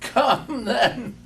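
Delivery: high, laughing and light; gaily. A narrator's reading. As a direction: toss it back with a laugh. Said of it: "returned the nephew gaily".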